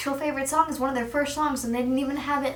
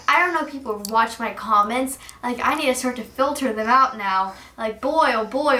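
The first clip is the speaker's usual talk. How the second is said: ordinary talk, nothing marked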